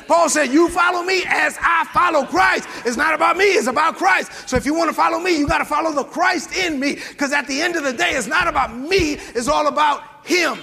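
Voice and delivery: rising, shouted preaching; preaching at full force. Real, not acted. Pastor driving the point home to the church, full-force preaching. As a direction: press the congregation hard, voice climbing.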